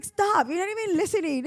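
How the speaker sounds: fed-up daughter telling mom to stop, mock-annoyed; exasperated daughter. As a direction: play the daughter cutting mom off.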